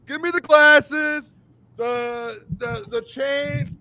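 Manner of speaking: high-pitched, rowdy celebration; real overexcited hype; worked up. Real, not acted. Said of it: "I'm getting worked up".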